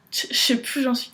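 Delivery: ordinary delivery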